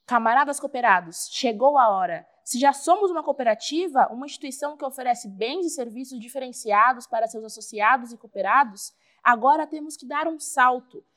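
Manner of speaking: loud rallying speech; rousing assembly speech. Acted. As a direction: stir up the comrades. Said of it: "iríamos ouvir algo assim".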